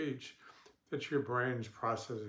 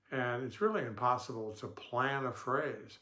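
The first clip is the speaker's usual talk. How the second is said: ordinary talk, nothing marked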